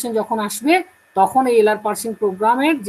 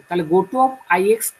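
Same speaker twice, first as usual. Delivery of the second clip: ordinary talk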